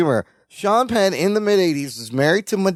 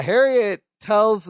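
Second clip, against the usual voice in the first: usual speaking voice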